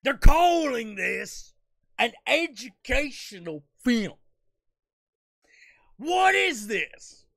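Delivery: hammed-up furious shouting preacher; outraged preacher. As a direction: rail at the film like a scandalized televangelist. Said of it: "Tom Kenny of SpongeBob fame going".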